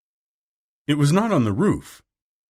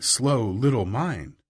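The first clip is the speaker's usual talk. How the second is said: ordinary delivery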